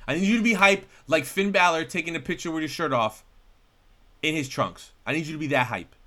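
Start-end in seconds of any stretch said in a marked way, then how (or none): 0.1-4.9 loud, higher-pitched insistent pep talk; hyping someone up. Real, not acted. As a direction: pump up your unenthusiastic co-host with a louder push. Demanding more hype from co-host.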